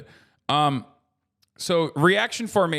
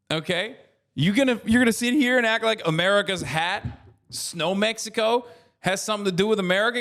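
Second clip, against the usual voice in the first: higher-pitched, heated indignation; indignant rant; 6 semitones higher than usual. Real, not acted. Streamer snapping back at a chat question about Peterson, pitched-up indignant rant. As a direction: fire back at the chatter, outraged and mocking.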